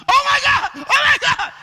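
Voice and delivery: high, loud shriek of mock alarm; mock panic. How the shepherd would not talk to his sheep, panicked cry. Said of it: "because she's already panicked".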